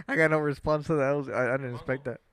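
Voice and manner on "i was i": ordinary talk, nothing marked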